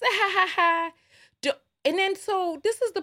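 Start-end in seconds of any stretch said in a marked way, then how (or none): 0.0-0.9 mocking laugh. Mock laugh at tweet.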